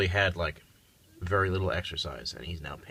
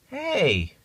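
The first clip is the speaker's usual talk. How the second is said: startled yelp; 12 semitones higher, 3 dB louder than usual. Real, not acted. Startled by cat.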